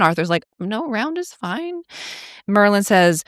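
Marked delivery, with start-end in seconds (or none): 0.6-1.8 meek agreement. Retold or imitated. Arthur hastily agreeing.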